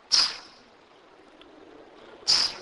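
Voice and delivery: isolated "ts" with a puff of air; "ts" as one breath-released consonant, no vowel; aspirated TS. Shown as a demonstration. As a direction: model a bare "ts" sound, aspirated. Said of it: "pronounced with an aspiration almost".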